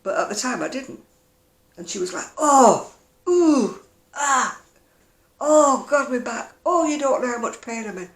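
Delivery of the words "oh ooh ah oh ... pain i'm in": high, loud imitation of groans of pain; moaning mum. To her audience, imitating her mum groaning about her back. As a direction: ham up her mother's endless moaning. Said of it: "she grumbled and groaned and moaned".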